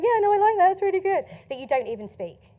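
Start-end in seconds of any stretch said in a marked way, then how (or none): 0.0-1.2 lightly voiced approving crowd, higher pitch; approving people. Imitating people who give validation and likes, lightly mock approval. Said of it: "desperate for validation and likes and people going".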